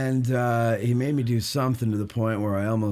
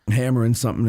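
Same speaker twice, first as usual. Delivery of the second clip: ordinary delivery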